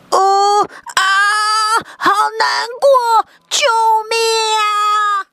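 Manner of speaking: high, slow wailing cry; fake crying for help. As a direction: wail and beg for help. Brother cries in fear.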